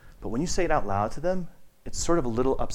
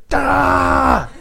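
very high, loud cartoon yell; brother's hammed-up outburst, high and loud; mock shout of Da; 10 dB louder than usual. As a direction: bark out a silly Da. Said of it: "He's like".

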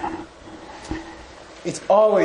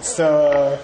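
ordinary delivery

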